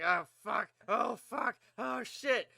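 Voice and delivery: high, loud panicked cursing; mock panic. Player with racing heart reaching the truck, loud mock panic. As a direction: curse in re-enacted fright, climbing in pitch.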